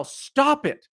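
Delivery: high-pitched cry of stop it; high shout. A narrator's reading. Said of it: "she shouted".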